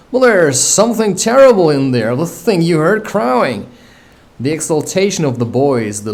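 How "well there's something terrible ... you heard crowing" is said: higher and louder, a scared pirate blurting it out; frightened. A narrator's reading. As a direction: voice up in alarm, shaken. Frightened pirate reports the thing.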